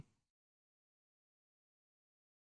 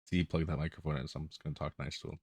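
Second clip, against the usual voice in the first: quiet aside; 6 semitones lower, 9 dB quieter, faster than usual. Real, not acted. Mocking the muted friend quietly.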